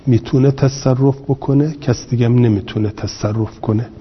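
usual speaking voice

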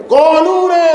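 high, sharp snapped retort; mock shout. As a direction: bark it like the officer scolding. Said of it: "یک دادی سر واسه".

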